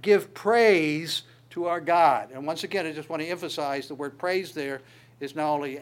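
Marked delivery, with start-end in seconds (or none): 0.0-2.3 loud, slow proclamation; voice from the throne. Retold or imitated. Preacher voicing the throne's command, loud and slow. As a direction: proclaim it slowly, leaning hard on praise. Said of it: "A voice came from the throne saying".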